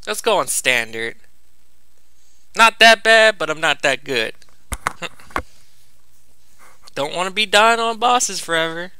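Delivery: ordinary delivery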